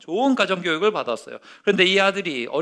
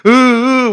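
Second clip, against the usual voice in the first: sob; 7 semitones higher, 13 dB louder, much slower than usual. Retold or imitated. Boy bursts into tears.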